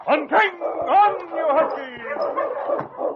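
high, loud, drawn-out cry to the huskies; straining musher's cry; shouting. Acted. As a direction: give the team everything in the final dash. Final sprint, driver urges team.

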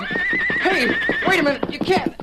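high, sudden yell at the bolting horse; startled shout. Acted. Boy thrown by his scared horse yells out high and loud. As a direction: cry out as the horse throws you.

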